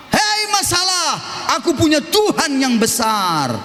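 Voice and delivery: high, slowed proclamation; bold declaration. Shown as a demonstration. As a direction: proclaim faith over the problem. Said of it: "You must say". (seruan iman yang tinggi)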